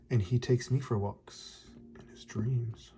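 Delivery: soft dreamy whisper. A narrator's reading. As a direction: breathe it out like a bedtime secret. Dreamy line read aloud.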